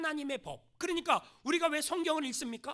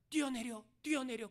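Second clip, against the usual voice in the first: a hint of a tempting devil, softer; lightly voiced devil's soft urging to jump; devil whispering; 3 semitones lower, 5 dB quieter than usual. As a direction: coax like a devil at someone's ear. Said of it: "마귀가 막 귀에다 대고 속삭이는 거야".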